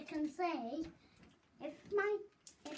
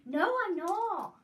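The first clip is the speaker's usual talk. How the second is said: loud; 7 semitones higher, 10 dB louder than usual. Real, not acted. Insisting denial.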